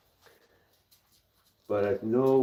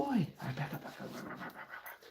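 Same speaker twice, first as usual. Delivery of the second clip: hushed dog command; 4 semitones higher, 16 dB quieter, faster than usual. Real, not acted. Coaxing dog backwards.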